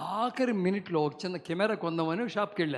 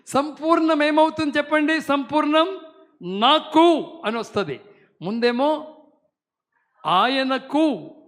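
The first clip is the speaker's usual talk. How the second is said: high, loud, slow exhortation with a laugh; full-force preaching; 5 semitones higher, 8 dB louder, slower than usual. Acted. Pastor to congregation, demanding the full verse in loud high preaching. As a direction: push them to say it all, loudly.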